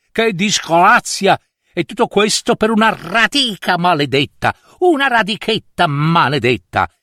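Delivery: hammed-up royal outrage; exasperated king. King laments and curses the root.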